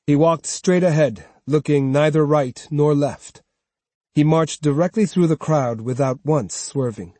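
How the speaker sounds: usual speaking voice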